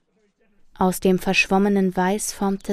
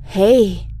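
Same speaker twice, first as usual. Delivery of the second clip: close call; 6 dB louder than usual. Acted. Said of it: "nur noch wenige Meter von ihr entfernt".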